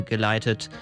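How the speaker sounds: usual speaking voice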